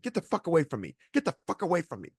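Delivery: retold outburst at normal volume; mock angry rebuff. Retold woman refusing to leave, angry.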